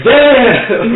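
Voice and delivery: loud, high roast; loud "damn". Real, not acted. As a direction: shout it out at the burn. Player mocks the NPC.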